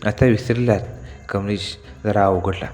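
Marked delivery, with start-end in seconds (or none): 0.1-0.8 playful tease. Acted. Kamini teasing bathing Kamlesh.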